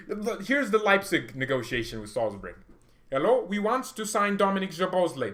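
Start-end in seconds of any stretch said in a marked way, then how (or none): 3.1-5.3 high, loud hammed-up German accent; exaggerated German accent, pitched up; mock German accent. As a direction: play a Leipzig exec in a silly German accent. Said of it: "That's R B Leipzig".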